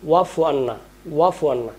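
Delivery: "ওয়াফু" quick, without the throat ain; common mispronunciation. Shown as a demonstration. Teacher shows common slip, "ওয়াফু" without ain. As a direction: say "ওয়াফু" smoothly as plain wafu. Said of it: "আমরা বলি".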